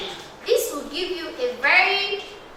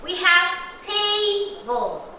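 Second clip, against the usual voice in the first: "table" drawn out slowly in two sung beats, TA-ble; slow clapped syllables; 3 semitones higher, much slower than usual. Shown as a demonstration. Teacher to class, singing syllables slowly. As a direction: sing "table" slowly, one beat per syllable. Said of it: "How many syllables?".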